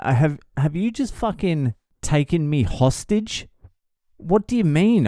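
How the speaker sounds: high-pitched mock outrage; retold protest, pitched right up; incredulous. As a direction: act out indignant disbelief. Incredulous retort at party delay.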